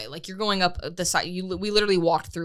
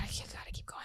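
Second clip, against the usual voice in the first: whispered self-push; 16 dB quieter than usual. Retold or imitated. Self-talk pushing up the hill.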